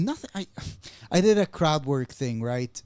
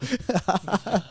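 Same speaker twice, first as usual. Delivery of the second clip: a short laugh, higher up; 5 semitones higher than usual. Real, not acted. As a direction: laugh at your own punchline. Laughing at own story.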